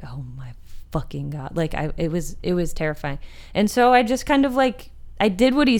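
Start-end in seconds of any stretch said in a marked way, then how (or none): 0.0-1.6 whispered in dread; horrified whisper. Retold or imitated. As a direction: breathe it out in horror. Retold terror at his size.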